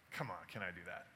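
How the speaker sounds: very low, soft brush-off; retold quiet bluffing; offhand bluff. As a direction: drop the voice right down, nonchalant.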